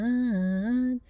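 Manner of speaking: high, drawn-out ghostly call; a teasing call from nowhere; calling name. Retold or imitated. Dead brother calling her name, high drawn-out call. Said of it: "I heard".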